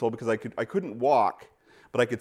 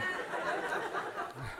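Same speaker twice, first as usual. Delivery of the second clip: soft breathy laugh; 13 dB quieter than usual. Real, not acted. Laughs at own joke.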